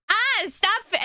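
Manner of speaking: high, startled mock protest; flustered protest. Real, not acted. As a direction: cry out as the gifts keep coming. Flustered by more gifts.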